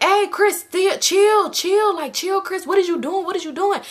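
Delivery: high, alarmed-sounding calls to calm down; hammed-up high-pitched pleading; mock urgent pleading. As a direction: imitate panicked friends talking someone down. Friends urgently calming Chris.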